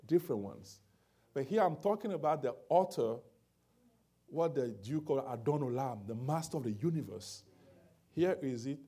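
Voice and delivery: loud, high, rising preacher's cry; preaching at full force. Acted. Preacher pressing the point on the congregation, loud and climbing.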